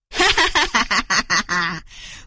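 long self-satisfied cackle; rapid-fire "ha ha ha" of a trickster; gloating laugh. Acted. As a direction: laugh in triumph over your victim. Fox gloats after tricking farmer.

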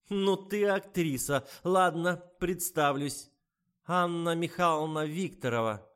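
high, loud and slow sarcasm; mocking old woman. A narrator's reading. Anna Mikhailovna mocks the writer and introduces herself, high and loud. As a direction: sneer at her acting.

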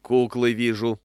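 loud, sour complaint; peevish, emphatic grumble; bilious, irritated. A narrator's reading. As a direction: complain loudly like an irked customer. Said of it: "говорил покупатель жёлчно".

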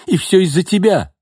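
blaming cry. A narrator's reading. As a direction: hurl the blame at him. Nina blames Gordey.

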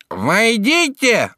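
high, loud, long-drawn nasal call. A narrator's reading. Judge calls second time through door, loud nasal shout. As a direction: stretch it out, loud and through the nose. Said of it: "только погромче".

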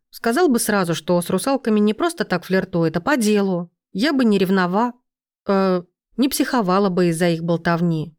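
plain reading voice

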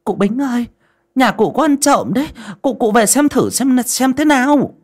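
high, loud, urgent call; breathless messenger stumbling over words; shouting in alarm. A narrator's reading. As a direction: cry out the bad news to the old woman. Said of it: "la lên".

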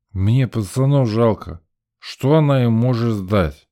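put-on slow drowsy drunken voice; sleepy, slurring drunk; getting drunker. A narrator's reading. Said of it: "засопел и стал клевать носом".

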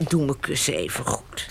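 ordinary talk, nothing marked

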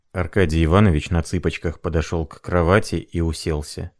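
ordinary delivery

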